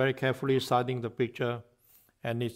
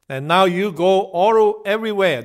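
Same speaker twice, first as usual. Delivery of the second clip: higher, louder command; 6 semitones higher, 10 dB louder than usual. Acted. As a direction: send him off with authority. Krishna sending Narada out.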